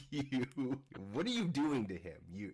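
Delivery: normal talking voice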